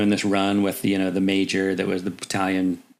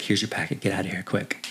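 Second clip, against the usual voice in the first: soft, lowered voice, urging under the breath; hushed hurry; 6 dB quieter than usual. Retold or imitated. Warrant officer slipping him the packet, hushed and urgent. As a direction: keep it down like you're sneaking him out. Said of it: "he's like".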